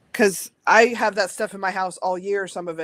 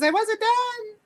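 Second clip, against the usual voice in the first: high, indignant little doll voice; slower than usual. Pretend. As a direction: squeak out a doll's protest. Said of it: "He says".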